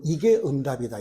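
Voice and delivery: usual speaking voice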